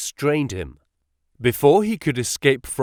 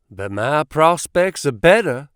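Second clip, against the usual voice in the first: a hint of heated rivalry, higher and louder; warmly; 4 semitones higher, 6 dB louder than usual. A narrator's reading. Rival suitor outbidding Drebber, a touch heated. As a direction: push the claim with light heat. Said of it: "said the other warmly".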